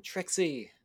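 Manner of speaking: imitated yell for help, pitched high; yelling a name. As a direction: call out for an ally far off. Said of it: "just yelling".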